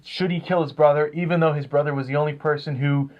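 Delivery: usual speaking voice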